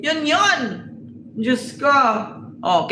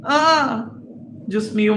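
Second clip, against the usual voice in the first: ordinary talk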